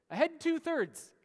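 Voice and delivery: loud, high, slow echo of the command; repeated order called back. Retold or imitated. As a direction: shout it back like a navigator confirming. Navigator repeating the order aloud.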